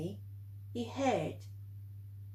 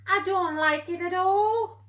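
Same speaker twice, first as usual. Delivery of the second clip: insistent owlet; 8 semitones higher, 6 dB louder than usual. Acted. Plop insists on his fear.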